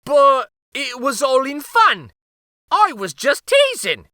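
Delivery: high, loud pleading wail. A narrator's reading. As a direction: beg not to be jailed. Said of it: "wailed Uncle Archibald".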